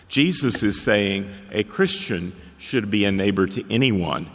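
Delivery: ordinary delivery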